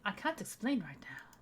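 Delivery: hushed, slow whisper in a bad French accent. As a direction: keep the secret, whisper it. Secretive character line, whispered.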